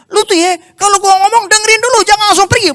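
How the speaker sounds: high, loud shouting friend; angry shout. Retold or imitated. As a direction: yell after him not to leave. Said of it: "En nggak berubah". (teman berteriak marah dengan nada tinggi dan keras)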